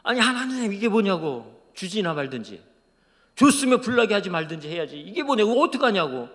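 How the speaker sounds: loud, high outcry at God; re-enacted shout of frustrated complaint; retold upset shouting. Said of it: "막 하나님께 소리 질렀대요".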